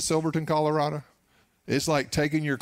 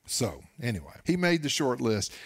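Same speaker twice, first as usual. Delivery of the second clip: normal talking voice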